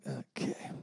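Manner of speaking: breathy whisper of forced cheer; whispered fake enthusiasm. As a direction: whisper it, pretending to be excited. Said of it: "I kinda walked in like".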